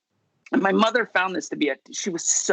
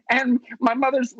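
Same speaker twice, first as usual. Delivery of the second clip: usual speaking voice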